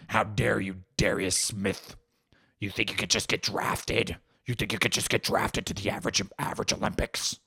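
mock-indignant troll voice; outraged online troll. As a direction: scold the athlete in a put-on sinister voice. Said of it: "they're just fucking tweeting at him".

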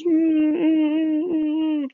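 muffled freak-out through a taped mouth; high muffled squeals. Retold or imitated. Taped-up girl trying to say let me out, muffled squeals. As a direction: imitate loud, high gagged squealing. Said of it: "She's freaking out".

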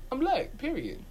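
usual speaking voice

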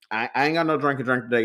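normal talking voice

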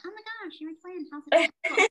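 high, excited gushing question; mock the overexcited fan; gushing typical person. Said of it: "that typical person".

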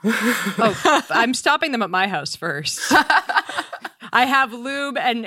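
giggling while talking; words broken by laughter; laughing. Real, not acted. Laughing through the joke.

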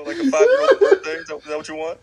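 squeaky high burst of laughter on birthday; laughing so hard the voice leaps up and goes loud; laughing mockery. Real, not acted. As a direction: crack up mid-tease, pitch jumping way up. Mocking birthday treat, laughing.

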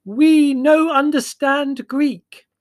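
high, drawn-out yell at foreigners. A narrator's reading. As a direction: bellow each word slowly and high. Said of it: "she yelled at the gesticulating drivers".

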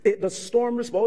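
ordinary talk

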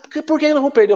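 high-pitched disbelieving question; teammate's high, incredulous why. Retold or imitated. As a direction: ask it as if he's lost his mind. Teammates' incredulous retort, retold.

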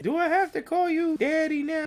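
put-on high, small little-girl voice; kid voice. The girl asking Bernie, high and small. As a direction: ask it like a shy little girl. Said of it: "she was like".

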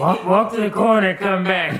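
high-pitched granny order; laughing mock command; bossy granny. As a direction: order him about, amused imitation.

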